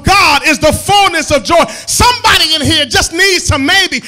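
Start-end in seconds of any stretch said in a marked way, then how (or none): 1.9-4.0 full-force preaching. Acted. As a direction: preach it out, rising to a shout. Preacher building to climax.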